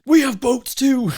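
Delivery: mock Coast Guard pride. Mocking the Coast Guard's harder boot camp, a hammed-up indignant boast. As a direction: puff up like an overcompensating recruit. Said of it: "a overcompensating thing".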